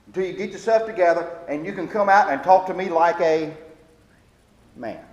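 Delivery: loud, firm dad sending his son away; stern father orders. Retold or imitated. Father sending his crying son to another room, loud and firm. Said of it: "he finally would tell him".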